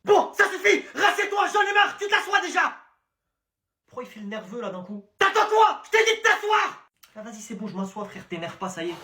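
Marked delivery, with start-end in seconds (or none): 0.0-2.7 high, shouted order to sit down; teacher yelling at a rude pupil; angry yell. Acted. Teacher loses temper. 5.2-6.9 high, shouted order to sit down; teacher yelling at a rude pupil; angry yell. Acted.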